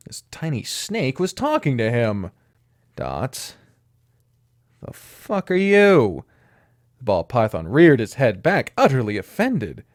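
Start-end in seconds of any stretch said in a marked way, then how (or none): 3.0-6.3 shocked. Acted. Cole shocked at talking snake.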